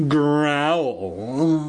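the word growl drawn out as a monster noise; hamster's growl. A narrator's reading. Giant hamster warning the teacher, drawn-out mock growl. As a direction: growl it long, like a huge beast. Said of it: "He let out a growl".

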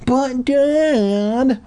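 high, drawn-out whine; whiny protest. A narrator's reading. Son pleads with dad to listen, whiny protest. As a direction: whine it like a let-down kid.

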